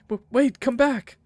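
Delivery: pleading shout, lightly acted; calling after. Calling after fleeing ghost in story.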